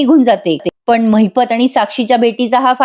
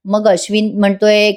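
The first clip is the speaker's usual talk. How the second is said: ordinary delivery, nothing marked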